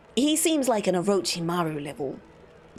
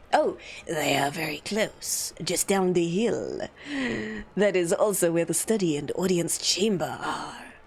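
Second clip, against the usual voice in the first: slow, lower ominous courtier; slower, darker-voiced formal reply; sinister bureaucrat; 4 semitones lower than usual. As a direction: draw it out slowly in a low official's voice. Redoes line in Achilles' voice.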